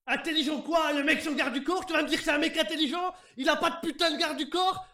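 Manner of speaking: loud, high, scornful; angry rant. Acted. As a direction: rant at them with contempt. Prisoner ranting at interrogator.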